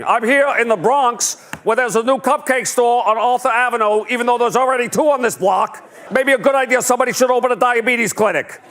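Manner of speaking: a local reporter two weeks from retirement; weary field reporter. Retold or imitated. Host mocking Bernie as a tired New York One reporter, high and weary. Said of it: "He sounds like a field reporter from New York One".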